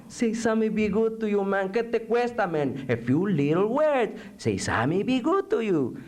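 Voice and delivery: high, wheedling barrio voice; pleading sidekick. Acted. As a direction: coax the prisoner in a high voice. Coaxing figure in poem.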